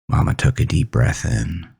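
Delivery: usual speaking voice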